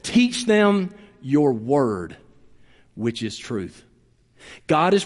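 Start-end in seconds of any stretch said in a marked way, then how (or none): 0.0-3.8 very slow, lower, fading almost to a whisper. Retold or imitated. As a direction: let the prayer fall away into a hush. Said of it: "And then he says".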